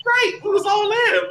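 very high, drawn-out holler; hammed-up high-pitched shout; mock emotional agreement. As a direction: cry out in exaggerated relief. Acts out agreeing with the song.

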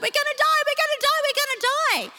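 high-pitched, hammed-up wail of doom; mock panicked screaming. Preacher imitates terrified Israelites at the sea, mock panicked screaming. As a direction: act out a frantic, repeated panic cry. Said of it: "like, screams like".

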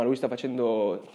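stuffy nose before blowing it; talking with a blocked nose. Real, not acted. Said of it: "devo soffiarmi il naso".

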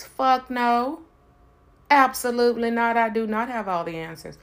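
loud and high, flat refusal; emphatic denial. Real, not acted. Answering her own question, loud emphatic no. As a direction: slam the door on the idea.

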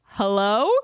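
loud, hesitant questioning hello; baffled answer. Retold or imitated. Two friends answering the elevator speaker, baffled hello. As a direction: imitate answering an unexpected voice. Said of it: "standing in the elevator just like".

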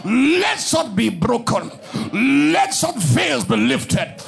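loud, high, chanted shouts of blessing and tongues; full-force preaching. Acted. Preacher calling the congregation to break free, shouting and chanting. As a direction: pour it out over the crowd, ringing and urgent.

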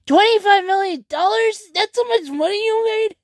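high, stunned disbelief; incredulous. Retold or imitated. Commenting on the streamer's salary, high stunned disbelief. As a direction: shoot the question up high in shock. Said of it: "I almost commented on that time saying".

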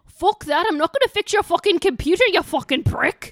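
loud, high angry Scottish rant; Scottish swearing demo. Shown as a demonstration. Showing how to cuss at work, loud Scottish accent. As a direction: swear like a furious Scot. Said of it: "you need to be like".

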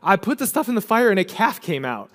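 high-pitched, mock-innocent excuse; comic retelling of a feeble excuse; Aaron's excuse. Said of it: "And Aaron's like".

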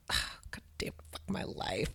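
whispered curse of defeat. Retold or imitated. Comic after a bad set, a defeated whisper. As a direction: mutter it under your breath after bombing.

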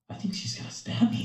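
high, hushed mock fear; squeaky half-whispered worry; mock dread. Coach aside while the kid glares, mock frightened.

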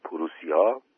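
put-on girl startled in the dark, asking with fear; fearful question. A narrator's reading. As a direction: ask it as if you can hardly believe it. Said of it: "با ترس پرسید".

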